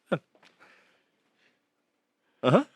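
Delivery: ordinary talk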